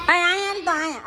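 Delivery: high sing-song call to a little one. Real, not acted. Mother calling her toddler over in a high coaxing voice.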